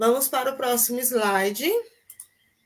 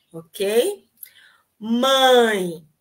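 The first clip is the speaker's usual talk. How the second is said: ordinary talk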